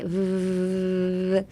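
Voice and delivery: long steady vvv hum; buzzing lip V. Shown as a demonstration. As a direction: teeth on lip, sustained vibration. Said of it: "唇噛んだ感じで".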